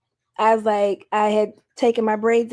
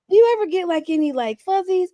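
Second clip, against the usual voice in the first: high, hesitant student question; a student timidly probing, pitched high; curious student; 7 semitones higher than usual. Retold or imitated. Student asking teacher about fuzzies in a high voice.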